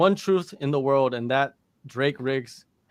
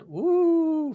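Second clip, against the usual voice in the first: whoop; 14 semitones higher than usual. Pretend. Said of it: "Shout out loud, loud, loud, very loud".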